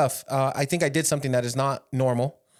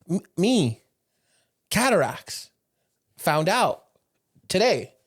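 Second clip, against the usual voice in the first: halting broken English, higher; choppy words in broken English; 5 semitones higher, slower than usual. Retold or imitated. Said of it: "In his broken English".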